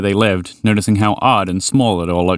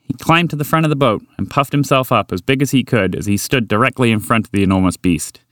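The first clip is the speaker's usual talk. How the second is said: ordinary delivery, nothing marked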